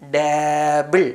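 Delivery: ഡാബിൾ drawn out with a rising end; slow sounding out. Shown as a demonstration. Teacher models ഡാബിൾ slowly for children to repeat. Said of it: "ഡാബിൾ എന്നാണ് വായിക്കുക".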